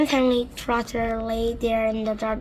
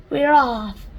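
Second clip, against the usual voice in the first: loud cheer; cried; 4 semitones higher, 8 dB louder than usual. Acted. Creatures cheering the departure, loud cheer. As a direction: cheer together. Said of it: "the others cried".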